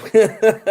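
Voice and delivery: a short, loud real laugh; burst of laughter. Real, not acted. Laughs at his own story.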